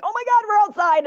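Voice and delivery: very high, loud, thrilled dog voice; so excited dog. Acted. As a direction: burst out the door like an overjoyed dog. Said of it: "they're so excited".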